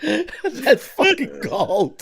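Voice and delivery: high, slow words squeezed through laughter; helpless laughing. Real, not acted. Host delighted by his friends' joke, laughing. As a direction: barely get it out for laughing.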